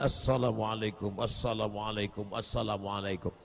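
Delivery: low, soft repeated salam; humbly greeting each person. Acted. Acting humble greeting to everyone. (salam lirih dan rendah)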